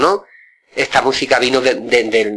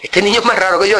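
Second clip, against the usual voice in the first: mock peeved uncle; 8 semitones higher, faster than usual. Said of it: "envenenado, se enfadaba mucho".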